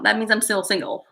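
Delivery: ordinary talk, nothing marked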